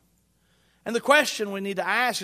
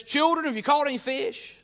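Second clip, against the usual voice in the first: higher, calling across the water; calling out from shore; 6 semitones higher than usual. Acted. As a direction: call out to the boat. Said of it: "Is exactly how he says it".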